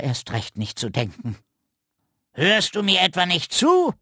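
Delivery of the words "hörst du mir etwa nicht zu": higher, sharp scolding raven; mock-stern raven snapping; snapping at him. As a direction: bark the question at the boy. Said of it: "herrschte ihn der Rabe an".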